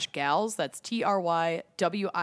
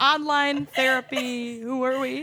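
drawn-out mock ad voice; 7 semitones higher, 4 dB louder than usual. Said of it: "I'm like".

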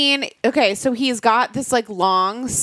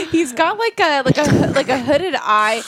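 laughing; 6 semitones higher than usual. Real, not acted. Laughing while describing him.

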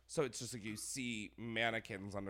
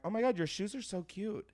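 mock fake gushing; 9 semitones higher, 4 dB louder than usual. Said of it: "I'm like".

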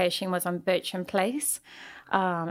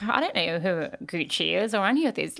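ordinary talk

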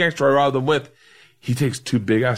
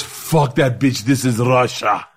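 put-on Russian accent. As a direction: play Igor in a thick Russian accent, taking his time. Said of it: "he goes".

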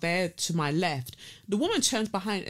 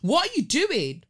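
high, loud indignant outburst; indignant telling-off, pitched high; exasperated scolding; 9 semitones higher, 5 dB louder than usual. Acted. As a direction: scold noisy theatregoers in disbelief. Scolding the noisy couple.

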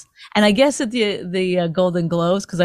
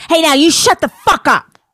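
louder, higher snap in a put-on voice; angry celebrity; 4 semitones higher, 6 dB louder than usual. Ranting host mocks a celebrity snapping at the piano player, loud. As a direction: bark it like a diva cutting off the band. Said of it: "the celebrity would be like".

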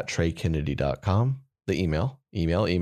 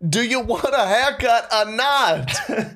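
Boston barber; 14 semitones higher, slower than usual. Pretend. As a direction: bark it like a gruff Boston barber. Barber impatient with customer.

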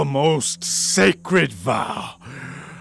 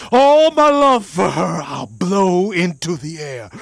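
usual speaking voice

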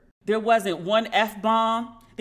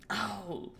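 breathy unvoiced sigh; whispered oh; 12 dB quieter than usual. Real, not acted. She whispers in dismay at herself. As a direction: drop to a whisper.

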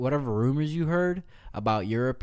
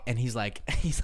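ordinary talk

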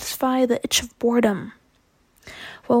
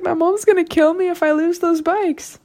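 panicked cousin; 6 semitones higher than usual. Retold or imitated. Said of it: "she's like".

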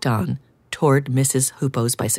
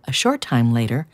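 ordinary delivery, nothing marked

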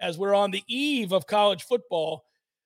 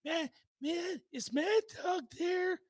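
put-on higher, soft, lost old man; confused old coach; 8 semitones higher, 6 dB quieter than usual. Old coach looking for Mad Dog on air, soft bewilderment. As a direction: ask faintly, as if to an empty line.

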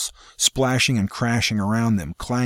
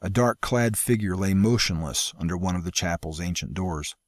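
plain reading voice

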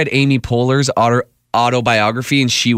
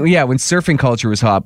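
normal talking voice

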